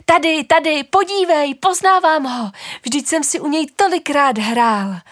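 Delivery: put-on high, loud joyful child shout. Child finds the other half and shouts to father, high joyful shout. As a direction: yell out with excited delight. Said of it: "Dítě v zápětí křičí".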